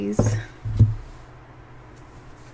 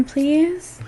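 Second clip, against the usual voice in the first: ordinary delivery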